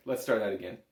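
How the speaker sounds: normal talking voice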